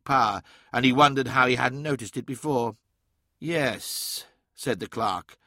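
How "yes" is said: soft, hesitant agreement; unsure. A narrator's reading. Clerk under psychic influence giving in, unsure. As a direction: agree softly without knowing why. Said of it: "sounding unsure but unable to say why".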